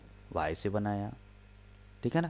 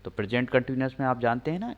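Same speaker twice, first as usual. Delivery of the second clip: ordinary talk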